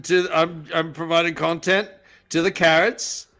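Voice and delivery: broad Aussie, listing with rising ends; Bondi Australian accent. Pretend. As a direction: explain it in put-on Australian. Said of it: "Bondi B".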